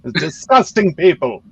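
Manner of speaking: lordly British accent, played for laughs. Shown as a demonstration. Comic lectures friends on uncivilized Americans, haughty British accent. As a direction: lord it over them in a put-on British accent. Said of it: "just do your best British accent".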